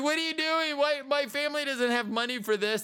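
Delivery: high-pitched, alarmed mock protest; play-acted indignant objection. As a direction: protest to your kidnappers in a high voice. Acting out protest to kidnappers.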